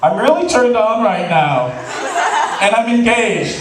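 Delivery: half-laughing blurt; confessing through the door to the friend, laughing; laughing confession. Retold or imitated. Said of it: "I'm like".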